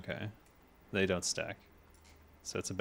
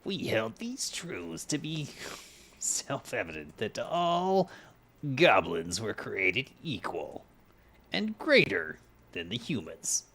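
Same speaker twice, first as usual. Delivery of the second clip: goblin voice; 2 semitones higher, slower than usual. Pretend. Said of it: "Do it in my goblin voi-, voice?".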